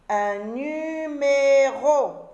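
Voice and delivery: numéro étiré, plus fort et lent; découpe numéro en syllabes, lentement; très lent, syllabé. Shown as a demonstration. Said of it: "syllabé puis normal". (dire « numéro » syllabe par syllabe)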